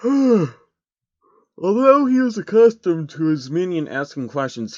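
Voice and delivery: exasperated slow restart after a sigh; weary reread. Real, not acted. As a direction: sigh and start the line over, fed up. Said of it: "sighs, rereading".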